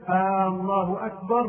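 "الله" begun with a long "آه"; lengthened first hamza. Shown as a demonstration. Teacher shows a meaning-changing addition, first hamza stretched. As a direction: hold the opening vowel of "الله". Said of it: "بمد همزة الله".

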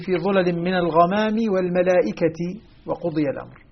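الملائكة carried into the next word on -ti; ta with kasra. Shown as a demonstration. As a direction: say الملائكة as al-mala'ikati, ending in -ti. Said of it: "قرأ بكسر التاء من كلمة الملائكة".

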